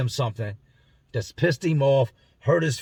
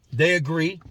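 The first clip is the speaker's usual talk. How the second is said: normal talking voice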